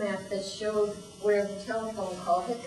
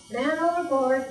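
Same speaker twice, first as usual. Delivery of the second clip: higher, louder alarm call; shouting; 4 semitones higher, 5 dB louder than usual. Acted. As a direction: call it out as a warning. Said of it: "the sailor cried".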